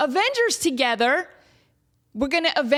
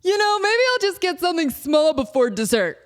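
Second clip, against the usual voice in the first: lightly voiced friend, casual and a bit louder; mocking friends; 4 semitones higher, 3 dB louder than usual. Mimicking a friend ordering food before dessert, lightly mocking. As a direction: mimic the friend's innocent excuse. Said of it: "Guess what these knuckleheads did".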